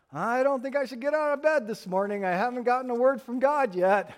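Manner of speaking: high-pitched mock fretting; hammed-up worry, pitched well up; over-pious believer. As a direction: voice a helplessly dependent believer. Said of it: "these are people who want to convince us".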